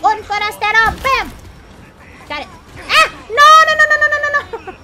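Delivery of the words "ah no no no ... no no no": shouted string of no, much higher and louder; panicked no. Real, not acted. Streamer cornered by villagers, panicked shouting. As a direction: yell no over and over in real panic.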